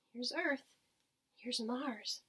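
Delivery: ordinary delivery, nothing marked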